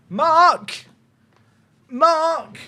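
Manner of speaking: high, far-off call as a dad yelling upstairs; calling for Mark. Pretend. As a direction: call your son from another room. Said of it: "Corrupt the Killer wants to know".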